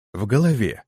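ordinary delivery

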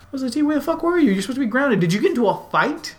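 fast, high furious scolding; angry parent. Retold or imitated. Parent scolding grounded son Wesley T, high and fast. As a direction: tear into the kid who snuck out.

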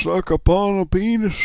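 put-on high, loud old man voice; squeaky old grandpa croak. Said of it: "you were doing Grandpa before".

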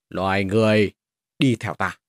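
metallic grinding dragon voice. Acted. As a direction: speak like a dragon, cold and grating. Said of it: "những âm thanh như ma sát kim loại". (giọng rồng rít như kim loại cọ xát)

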